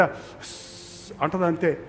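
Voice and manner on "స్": a soft whispered shush. Retold or imitated. Mother hushing her crying baby with a soft shush. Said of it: "అమ్మ స్వరం వినగానే బిడ్డ".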